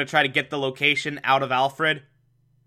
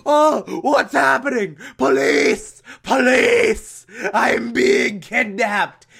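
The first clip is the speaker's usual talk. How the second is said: high, loud, frantic shouting for help; panicked cry for police; 9 semitones higher, 5 dB louder, slower than usual. Retold or imitated. Alfred panicking as he's grabbed, frantic shouts. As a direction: yell for the police like you're being snatched. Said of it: "takes this as a criminal act and is like".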